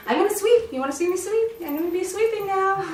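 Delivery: high sing-song taunt about sweeping; teasing excited owner. Retold or imitated. Owner teasing dog with the broom, high mock excitement. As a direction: tease the dog with the broom, hammed up. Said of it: "Don't be like".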